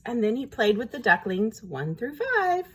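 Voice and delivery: ordinary delivery, nothing marked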